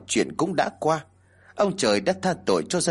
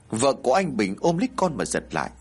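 ordinary delivery

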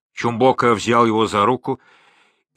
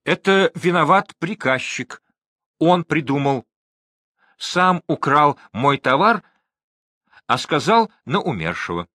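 higher-pitched, hasty-sounding excuse; self-justifying; 6 semitones higher than usual. A narrator's reading. Trader blaming his clerk before the crowd, self-justifying. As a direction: shift the blame in a raised voice.

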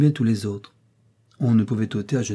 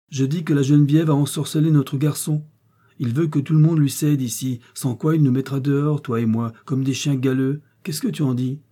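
higher, louder indignant rant; heated complaint; 5 semitones higher, 5 dB louder than usual. Acted. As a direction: stir up her husband against the son. Mother complaining, heated, to husband.

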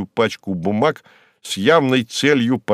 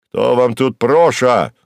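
loud, slow, offended hauteur; haughty; 5 dB louder, slower than usual. Acted. Empty suit to the secretary, haughty. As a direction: ask it loudly with pompous disdain. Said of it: "осведомился надменно костюм".

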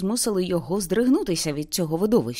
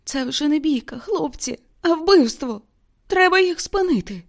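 much higher, trembling and frightened; 10 semitones higher than usual. A narrator's reading. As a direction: plead to stop it, voice shaking. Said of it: "промовив тремтячим голосом".

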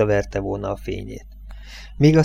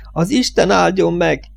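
higher, calling after the truck; woman calls a blessing to her departing lover, higher; called out; 7 semitones higher, 3 dB louder than usual. A narrator's reading. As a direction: shout the blessing after him. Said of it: "kiáltotta Linda még egyszer".

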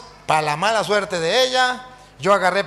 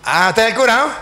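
louder, teasing question; amused, mocking pastor; 3 semitones higher, 5 dB louder, faster than usual. Laughing pastor greets the priest, mockingly amused. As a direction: imitate a pastor gloating at the priest. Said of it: "Llegó el pastor riéndose, me dice".

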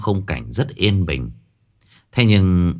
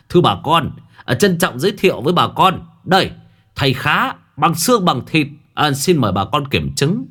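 higher, faster hawker's pitch to the crowd; showman's announcement; 6 semitones higher than usual. Acted. Khánh grandly introduces his master to the villagers, showman's voice. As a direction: sell it like a market barker.

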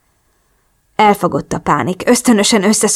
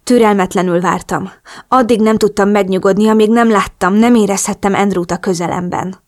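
plain reading voice

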